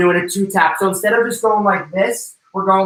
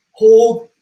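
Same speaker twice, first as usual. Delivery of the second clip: sharp, louder call of hold; coach's call; 6 semitones higher, 7 dB louder than usual. Real, not acted. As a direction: bark the cue to hold the pose.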